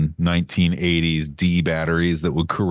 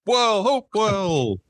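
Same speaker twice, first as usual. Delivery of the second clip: mock excitement; 12 semitones higher, slower than usual.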